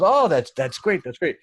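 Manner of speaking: light up at the idea; delighted. Retold or imitated. CEO reacts after hearing explanation.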